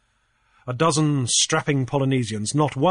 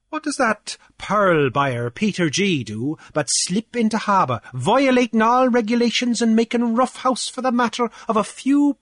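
pompous official, pitched higher, boasting; blustering Cornelius; 7 semitones higher, 3 dB louder than usual. Acted. Cornelius gloating to Grief over the fines, higher and pompous. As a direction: puff up like a petty island official lording it.

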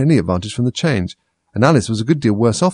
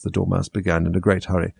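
ordinary delivery

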